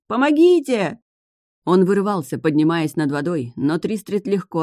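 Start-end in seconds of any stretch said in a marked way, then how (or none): 0.1-1.0 much higher, louder panicked squeal; shrieking. Acted. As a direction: squeal for help. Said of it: "верещал Лински".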